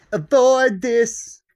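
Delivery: high, loud, very slow command; mock business instructor. Business course teacher giving rules, mock instructor voice. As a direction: parody a coach drawing out the order.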